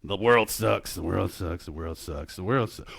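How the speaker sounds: low, quiet droning monotone; doom scrolling. Acted. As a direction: drone it on repeat, glum and very low. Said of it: "probably at the time, doom scrolling".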